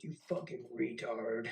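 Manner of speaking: mild mock yell; retold chewing-out; screamed insult. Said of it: "you just get screamed at".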